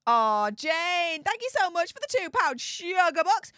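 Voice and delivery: high, gushing donor shout-out; excited thanks. Real, not acted. Streamer thanks viewer for two pound sugar box, excited. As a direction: thank them with a big high-pitched flourish.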